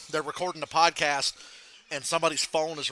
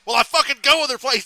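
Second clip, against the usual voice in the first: loud, raised mock anger; Bernie getting mad; 8 semitones higher, 10 dB louder than usual. Imitating Bernie arguing about airports, getting mad. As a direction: snap back, imitating a rising temper. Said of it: "Starts getting mad".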